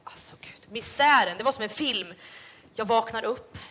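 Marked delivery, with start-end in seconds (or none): 0.0-0.6 breathy whispered aside. Real, not acted. Telling friends, whispered in disgust. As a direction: drop to a hushed whisper in disgust.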